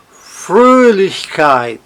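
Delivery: "Fröhlichkeit" slowly, with FRÖH drawn out; stress on FRÖH. Shown as a demonstration. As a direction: hit Fröh in "Fröhlichkeit", keep keit unstressed. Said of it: "Está no Frö".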